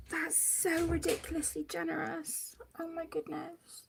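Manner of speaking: real crying, words held back; tearful. Real, not acted. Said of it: "I'm a blubbering mess".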